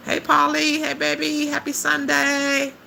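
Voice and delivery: very high, drawn-out singsong hello; high-pitched gushing welcome, stretched at the end; gushing greeting. Real, not acted. Greets a viewer affectionately.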